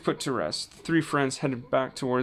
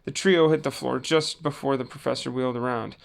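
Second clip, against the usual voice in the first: plain reading voice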